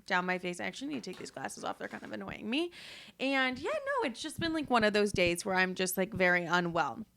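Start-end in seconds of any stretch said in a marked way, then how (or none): none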